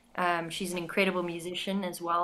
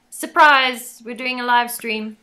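high, loud cheery "surprise!"; mock surprise announcement; 4 semitones higher, 10 dB louder, slower than usual. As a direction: play it like a sudden reveal. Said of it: "it was just sort of like".

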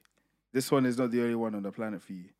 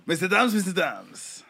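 slow, higher, louder announcer-style call; show intro voice; 6 semitones higher, 5 dB louder, slower than usual. Pretend. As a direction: call it out like a segment jingle. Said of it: "That's not how you do it".

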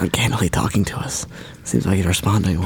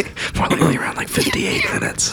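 hushed unvoiced whisper. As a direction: whisper so he won't hear. Hiding in closet, whispering.

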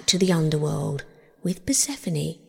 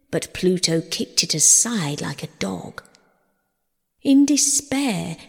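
ordinary delivery

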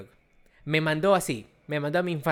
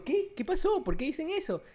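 higher, lightly voiced puzzled mother; mom confused; 11 semitones higher than usual. Retelling his mother's confusion, higher puzzled voice. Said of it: "Mi mamá dijo".

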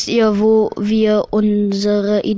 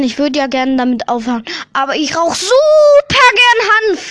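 quoting the podcast guest; 4 semitones higher, 4 dB louder than usual. Retold or imitated. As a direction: voice jumps high and loud on the last line. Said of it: "diese Betonung war halt".

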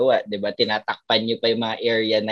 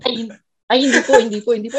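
higher, flustered denial with a laugh; flustered relative; 9 semitones higher, 5 dB louder, much faster than usual. Retold or imitated. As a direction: play the embarrassed relative backing off. Relative apologizing in retold story. (nahihiyang kamag-anak na tumatanggi)

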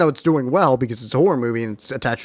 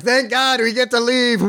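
relieved cheering; 9 semitones higher than usual. Retold or imitated. Mocking clapping audience members, high relieved cheer. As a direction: cheer like a freed moviegoer, hammed up. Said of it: "Maybe they were just clapping 'cause it was over".

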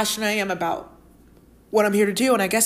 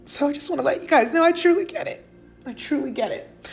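tearful; 7 semitones higher than usual. Real, not acted. Host reassuring lonely listeners while tearing up, tearful. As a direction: tell them through real tears that you get it. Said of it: "I don't know why I'm tearing up".

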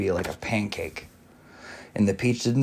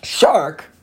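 high, loud startled earthworm shriek; frightened shriek; 6 semitones higher, 4 dB louder than usual. A narrator's reading. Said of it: "shrieked the earthworm".

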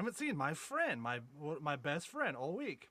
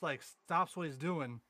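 ordinary talk

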